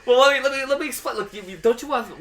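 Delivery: normal talking voice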